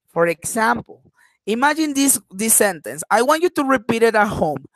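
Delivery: ordinary talk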